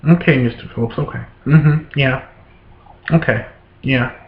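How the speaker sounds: low, soft, bored clerk murmuring okay. Retold or imitated. Caseworker brushing off a father, low and bored. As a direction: mimic a clerk half-listening with lazy okays. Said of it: "she's sitting over there like this".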